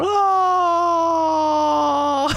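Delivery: high, loud mock cry dropping down; a hammed-up howl sliding down; falling wail. The followers' voice drops in a high, loud mock wail. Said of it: "you're gonna just hear maga l-, his voice going down".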